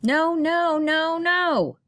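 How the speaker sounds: high, loud yelled no. Acted. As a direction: yell even harder at the bird. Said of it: "Mara yelled louder".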